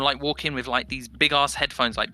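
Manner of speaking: ordinary delivery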